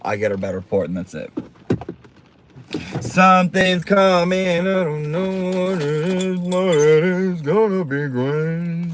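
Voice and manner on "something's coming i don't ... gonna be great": higher, slow, drawn-out tease; mysterious teaser. Pretend. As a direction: draw it out slowly like a spooky promise. Teasing a surprise.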